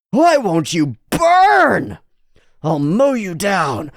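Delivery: slow, high, loud furious cry; berserk rage. Acted. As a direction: howl at the tree. Berserk Agni frustration.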